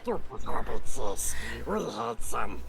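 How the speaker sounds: loud, slow Gollum snarl. Acted. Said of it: "practicing my Gollum voice".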